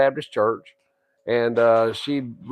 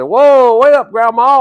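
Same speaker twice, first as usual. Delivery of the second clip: loud, high call to stop her; 8 semitones higher, 6 dB louder than usual. Retold or imitated. Grandson calling out to grandma on her bicycle, loud and high. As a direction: shout after her to wait up.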